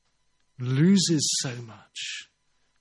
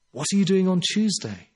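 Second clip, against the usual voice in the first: lightly voiced, higher-pitched friend's invitation; a hint of a hospitable hostess, higher; imitating hospitable Highland woman; 6 semitones higher, 4 dB louder than usual. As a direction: lift it lightly, inviting a student over. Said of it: "It's West Highlands".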